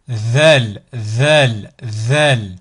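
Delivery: Zal with the tongue tip out between the front teeth; Zal, voiced "th" pushed out past the incisors. Shown as a demonstration. Teacher demonstrating the Arabic letter Zal, tongue between the teeth. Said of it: "la langue doit sortir au niveau des incisives".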